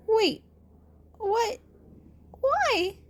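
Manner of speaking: high, confused questions; startled boy. Acted. Izuku surprised that Aizawa cares. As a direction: caught off guard.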